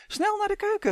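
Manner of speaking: very high, hurried rallying cry; calling out. A narrator's reading. Twin rushing brother to the kitchen, calling out. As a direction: pipe up with an eager call. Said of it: "riep Tummy".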